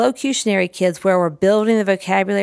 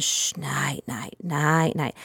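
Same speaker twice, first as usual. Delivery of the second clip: soft and low, much softer; hushed night-night; 4 semitones lower, 7 dB quieter, much slower than usual. Shown as a demonstration. Putting the toy to bed for the child, hushed. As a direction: lull it down gently. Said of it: "crouch down real low".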